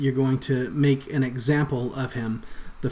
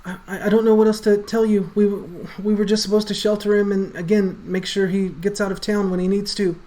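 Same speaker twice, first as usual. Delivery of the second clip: higher, faster, stammering in fear; panicked stammer; 8 semitones higher, 4 dB louder than usual. Acted. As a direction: blurt it out terrified. Rat NPC panics.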